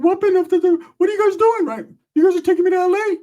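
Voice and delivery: very high, loud panicked whine; Ricky's squeaky voice. Retold or imitated. Ricky asking where his friends are driving him, high panicked whine. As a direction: squeal it, baffled and alarmed.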